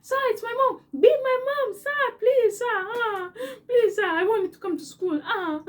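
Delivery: high, loud hammed-up wail in Kenyan accent; mock bawling plea, begging for mercy; crying in Kenyan accent. Pupil blaming mom to vice principal, mock crying in Kenyan accent. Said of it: "I picked up that accent so fast".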